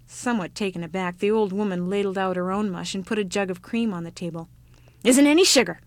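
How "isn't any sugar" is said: loud, high, tart reply. Acted. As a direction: refuse curtly and tartly. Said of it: "she said tartly".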